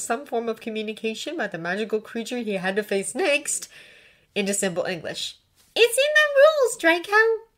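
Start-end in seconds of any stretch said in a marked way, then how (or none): 5.8-7.5 high, loud, insistent reminder; insistent scolding. A narrator's reading. Hermione reminding Draco of the rules, scolding.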